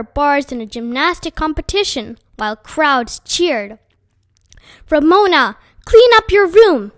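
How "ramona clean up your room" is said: much louder and higher, a mother yelling; raised voice. A narrator's reading. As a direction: repeat the order at full volume, out of patience. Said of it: "Missus Quimby raised her voice".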